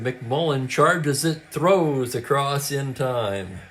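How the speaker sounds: usual speaking voice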